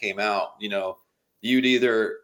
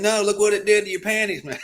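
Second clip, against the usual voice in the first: Larry the Cable Guy impression; 10 semitones higher, faster than usual. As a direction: throw out a hammed-up country come-on. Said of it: "He goes".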